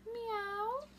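high, far-off kitten mew; cat meow. Acted. At the vet, puppy George meows like a cat. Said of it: "George went".

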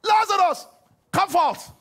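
huge high shout; commanding bellow; loud cry. Acted. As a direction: call the dead out of the tomb. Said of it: "he cried with a loud voice".

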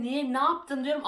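a pupil snapping back at the teacher, high and loud; indignant retort. Retold or imitated. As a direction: fire back in disbelief.

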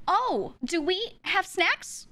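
high, slow, stagey wonder; hammed-up kid faking a sudden idea; overly emoting kid. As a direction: act it out like a bad skit. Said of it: "overly emoting".

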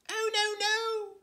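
very high, loud little fly protest. Acted. Fly refusing the spider's bed, high frightened squeak. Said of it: "said the little fly".

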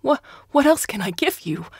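high, loud, panicked plea; hysterical. A narrator's reading. Prisoner begging his interrogator, hysterical. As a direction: beg in hysterics. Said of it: "Kinneoid Stationer was hysterical".

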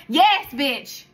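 emphatic loud retort; loud sassy answer. Real, not acted. Answering the mock question.

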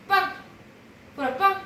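talking to a dog. Real, not acted. Commanding the dog to play dead.

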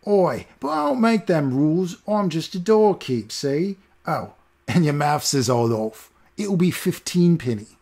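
high, slow smug cockney; smug cockney doorman. Acted. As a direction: gloat over the sum like a petty gatekeeper. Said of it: "he said smugly".

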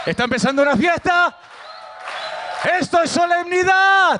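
high, shouted preacher's proclamation; festive proclamation. Real, not acted. Shouts over a festive outburst. (proclamación festiva a gritos)

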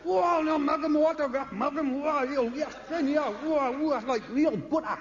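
a copper losing it at seeing his idol, excited yelling; starstruck copper. Retold or imitated. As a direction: gush like a fan spotting his hero.

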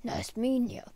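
put-on breathy old grandpa whisper; grandpa penguin whisper. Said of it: "Grandpa Penguin and Baby Hulk".

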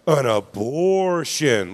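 slow, drawn-out spooky imitation. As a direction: stretch it out like a mock ghost story. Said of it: "Like that all spooky".

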